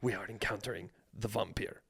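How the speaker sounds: put-on low, soft Eastern European vampire accent; hushed Slavic-vampire accent, half laughing; mock vampire accent. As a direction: play Dafoe's vampire hunter in a thick Eastern European accent. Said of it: "That was a good accent".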